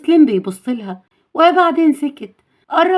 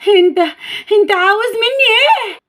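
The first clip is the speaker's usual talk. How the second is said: put-on high, scared girlish voice; acted fearful, halting question; frightened; 9 semitones higher than usual. A narrator's reading. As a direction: back away in fear, voice raised high. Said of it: "كانت بترجع لورا بخوف".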